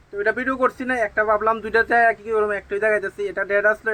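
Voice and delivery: ordinary talk, nothing marked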